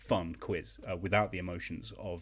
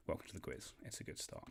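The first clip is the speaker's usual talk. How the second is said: much softer, half-whispered demo; demo aside; 3 semitones lower, 13 dB quieter than usual. Shown as a demonstration. Host showing the quiz screen, soft muttered aside. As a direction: mutter it as a demonstration. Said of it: "I will show you like this".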